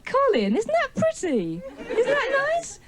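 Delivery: put-on high, slow, patronising baby talk; mock cooing. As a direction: coo at him as if he were a little child. Cooing at audience man like child.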